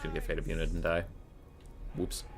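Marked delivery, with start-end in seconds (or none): 1.9-2.2 very soft little slip of a word; understated oops. Real, not acted. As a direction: murmur it quietly to yourself. Small first reaction to own mistake.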